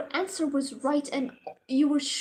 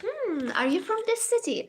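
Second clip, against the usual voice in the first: lightly mocked professor, pitched higher; quizzing professor; 6 semitones higher than usual. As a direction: imitate a probing teacher with a higher, musing hum. Imitating professor quizzing student.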